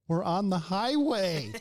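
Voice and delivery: loud, high, drawn-out protest; retold incredulous shout; exasperated yell. EMT answers patient asking for the door, exasperated loud yell.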